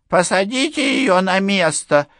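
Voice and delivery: put-on high, pushy old general; stern general. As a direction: press him like a suspicious old officer. General orders Enderby. (напористый голос генерала)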